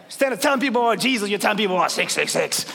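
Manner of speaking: fiery pastor charging the church to act; full-force preaching. Acted. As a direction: preach it hard to wake the room.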